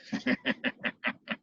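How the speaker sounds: soft breathy sinister laugh; evil whispered snicker. Acted. Pooka laughing at its trick, soft evil snicker.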